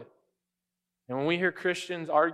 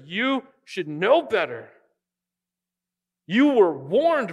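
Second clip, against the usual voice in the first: slow, louder, stern warning; stern words, slowed right down; 3 semitones higher, 6 dB louder, slower than usual. Retold or imitated. Jesus warning the religious leaders, slow and loud. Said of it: "He says".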